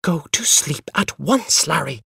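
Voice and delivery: sharp. A narrator's reading. Mother orders interrupting son to sleep, sharply. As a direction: order the child sharply, word by word. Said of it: "she said sharply".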